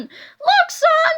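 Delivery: put-on high, loud old lady calling out. A narrator's reading. Old lady points out a squid to her son, high and loud. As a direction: pipe up as an excitable old mother. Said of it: "said the old lady".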